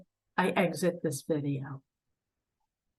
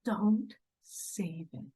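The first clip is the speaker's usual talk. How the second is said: usual speaking voice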